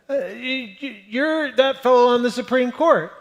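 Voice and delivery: a fan stammering with excitement; eager stranger. Retold or imitated. Stranger accosting the justice.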